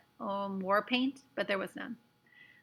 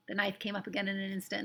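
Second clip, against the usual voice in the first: ordinary delivery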